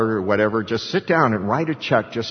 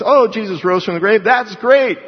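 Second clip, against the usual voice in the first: higher and louder, breezy lip-service cheer; mock casual shrug; 9 semitones higher, 4 dB louder than usual. Preacher mocking lukewarm Christians, hammed-up cheery shrug. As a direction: act delighted, then move on. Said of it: "just like so many Christians".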